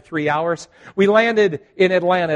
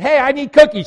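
higher, louder call across the aisle; 9 semitones higher, 10 dB louder than usual. Retold or imitated. Pastor calls across the plane for more cookies, loud and high.

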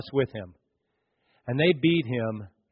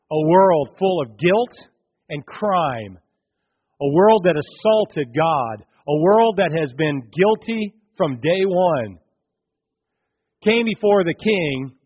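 slow, louder preaching build; 2 semitones higher, 5 dB louder, slower than usual. Acted. Preacher telling the church of the world's guilt, slow and rising. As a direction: drive it home from the pulpit.

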